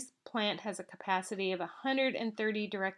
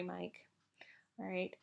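a soft unvoiced murmur; whispered correction; 2 semitones lower, 10 dB quieter than usual. Real, not acted. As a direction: whisper the name's correction as an aside.

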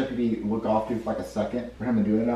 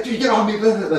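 high, loud mock objection; annoyed protest; 11 semitones higher, 6 dB louder than usual. Shown as a demonstration. Director shows the chef rejecting a kiss, annoyed. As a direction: shove back with a mock complaint. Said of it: "And you go".

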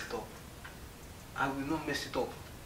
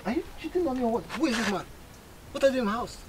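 jealous accusing; 6 semitones higher, faster than usual. Acted. Husband accuses wife and boss.

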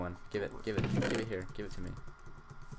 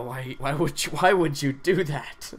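mock outrage; 4 semitones higher, 8 dB louder, slower than usual. Bed dropped on him, outraged.

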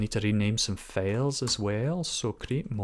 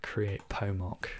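ordinary talk, nothing marked

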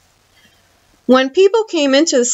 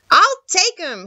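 put-on higher-pitched boy deciding happily; eager boy; 7 semitones higher than usual. A narrator's reading. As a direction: pitch it up like a keen child. Boy decides to take robot.

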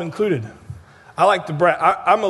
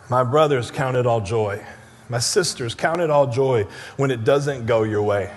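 lower, softer, tender appeal; tender exhortation; 9 semitones lower, 4 dB quieter than usual. Acted. Preacher tenderly urging brothers and sisters, soft and low. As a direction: gently urge the church to rejoice.

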